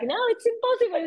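high, disbelieving voice; imitated high disbelief; incredulous friend. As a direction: mimic a friend who won't believe it. Friend refusing to believe.

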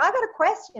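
high-pitched pupil imitation; kid's question. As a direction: pipe up like a child with a question. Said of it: "the kids are like".

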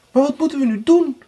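high, quick and startled; alarmed cry. A narrator's reading. Anna hears Erika's news, crying out alarmed. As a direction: blurt it out in shock. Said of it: "riep Anna geschrokken uit".

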